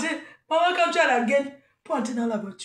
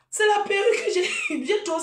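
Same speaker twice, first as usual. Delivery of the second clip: laughing; 7 semitones higher than usual. Real, not acted. Laughing about tight wig.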